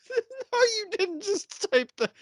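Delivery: high, incredulous gasp of disbelief; disbelieving. Retold or imitated. Laughing at a text message, high disbelieving squeal. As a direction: squeal like you can't believe he typed it. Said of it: "I was like".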